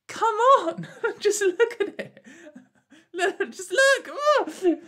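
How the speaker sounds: high, loud, laughing squeal of exasperation; exasperated disbelief. Real, not acted. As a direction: plead in helpless, high-pitched disbelief. Exasperated laughing disbelief at self-owns.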